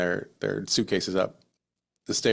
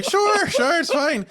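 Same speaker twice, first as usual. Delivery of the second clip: very high, loud mock reassurance; squeaky-high retold guide voice; Irish tour guide; 6 dB louder, much slower than usual. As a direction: breezily brush off doubts as an imitated guide. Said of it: "And he's like".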